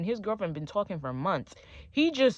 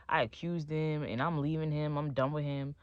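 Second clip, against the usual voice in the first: usual speaking voice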